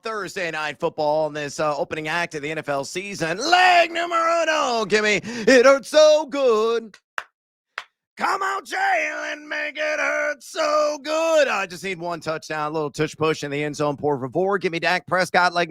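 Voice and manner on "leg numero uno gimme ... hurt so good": high, slow hyped-up yelling; excited hype shout. Acted. Hyping his first bet pick.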